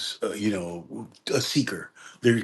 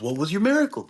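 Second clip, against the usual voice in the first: student asks; 9 semitones higher, faster than usual. Retold or imitated. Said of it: "the guy goes".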